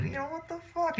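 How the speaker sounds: very high, whining mockery; mocking whiny voice. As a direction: whine it like a crybaby. Continued mockery.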